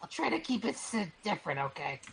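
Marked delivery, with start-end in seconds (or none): 0.0-0.9 high character voice. Acted. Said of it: "These voices might be too similar".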